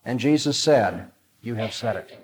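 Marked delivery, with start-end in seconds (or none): 1.4-2.2 brief, noble answer, much softer and a little low; quiet certainty. Retold or imitated. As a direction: say it with hushed, unforced confidence. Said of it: "Look at the nobility, the confidence, the brevity and certainty".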